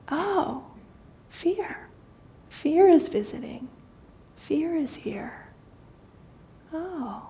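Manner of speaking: hushed and high, like welcoming a guest; kind tone of voice. Shown as a demonstration. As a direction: greet the fear kindly, almost tenderly. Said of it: "And you notice the tone of voice".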